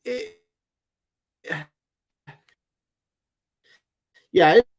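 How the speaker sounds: choppy, robotic connection dropouts; robotic glitchy audio. Real, not acted. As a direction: voice breaking up like a bad connection. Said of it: "you turned into a robot there for a second".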